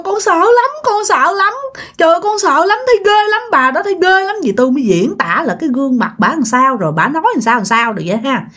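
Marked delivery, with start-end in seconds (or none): 0.0-4.4 high, scared, repeated pleading; frightened insistence. Acted. Girl insisting to her family it was real, frightened pleading.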